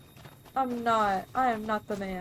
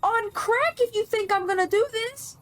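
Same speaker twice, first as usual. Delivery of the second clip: indignant outburst; 11 semitones higher, 3 dB louder than usual. Real, not acted. Outraged at being sent in.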